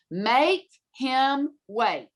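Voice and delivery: each word drawn out very slowly; emphatic instruction. Retold or imitated. Headmistress giving parents an assignment, imitated slow, emphatic order. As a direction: lay down the rule word by word, stretched long.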